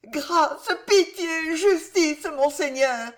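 high, pleading cries on her knees; crying out for mercy. Acted. Jeanne on her knees before the constable: high pleading cries. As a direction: fall to your knees and beg. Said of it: "cria Jeanne en tombant à genoux".

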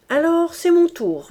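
put-on loud, high, thick-tongued drunk stammer; drunk slurred voice. A narrator's reading. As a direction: stammer it like a loud tipsy reveller. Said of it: "bégayait Coupeau d'une voix pâteuse".

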